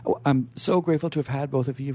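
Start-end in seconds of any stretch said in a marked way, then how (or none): none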